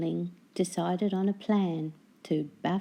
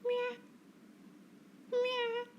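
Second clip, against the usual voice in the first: very high, soft mewing; pitiful meow; 8 dB quieter than usual. Acted. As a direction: mew like a miserable seasick cat. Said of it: "agreed Hamish pitifully".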